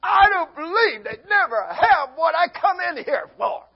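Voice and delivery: hammed-up crying shout; throwing a fit. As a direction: throw a fit over missing groceries. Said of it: "go off the deep end and have a fit".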